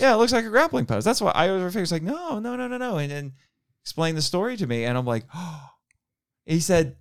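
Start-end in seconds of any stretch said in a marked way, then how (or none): 2.0-3.0 high, soft string of denials; imitated dismissal. Guest correcting a fan's guess about the stance, imitated dismissal. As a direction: wave it away in a high, softer voice.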